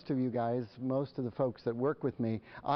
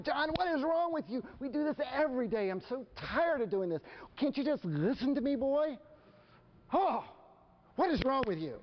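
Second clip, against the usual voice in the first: much higher, mock furious yell without extra volume; screaming at son; 12 semitones higher than usual. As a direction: act out losing it at the kid. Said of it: "I am screaming at John".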